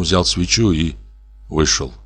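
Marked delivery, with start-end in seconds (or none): none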